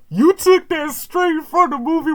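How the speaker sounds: squeaky high-pitched teasing. Real, not acted. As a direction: tease him in a squeaky high voice. Calls out copied movie line.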